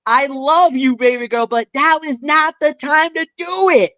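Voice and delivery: exasperated pleading. Retold or imitated. Fan telling off Tiffany for interfering, exasperated pleading. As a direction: scold a favorite lovingly, voice rising.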